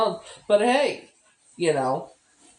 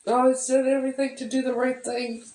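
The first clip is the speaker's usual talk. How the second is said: high, tearful voice near breaking; real crying through the words; choked-up, teary; 8 semitones higher than usual. Real, not acted. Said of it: "I don't know why I'm crying".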